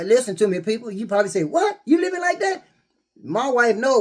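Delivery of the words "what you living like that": mock disbelief. Imagined viewer reacting to his separate room, high shocked disbelief.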